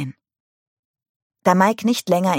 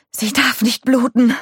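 high, louder breathless panic; gasping; 7 semitones higher, 4 dB louder than usual. A narrator's reading. Nick pressing on the wound, gasping in panic. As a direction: gasp it out, desperate. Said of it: "keuchte Nick".

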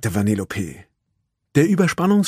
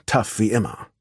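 ordinary delivery

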